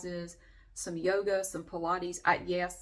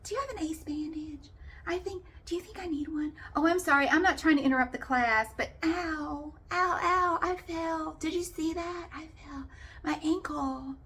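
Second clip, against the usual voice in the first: whiny attention seeker; 10 semitones higher than usual. Retold or imitated. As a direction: ham up the injured drama queen, fishing for sympathy. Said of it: "Through the entire freaking class".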